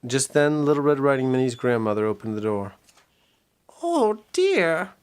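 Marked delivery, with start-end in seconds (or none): 3.8-4.9 high, lightly voiced old lady; old granny voice. Sick grandmother greeting Red, high old-lady voice. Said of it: "That was a really bad voice".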